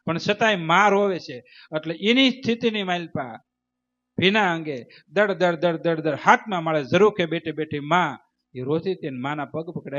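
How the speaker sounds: ordinary talk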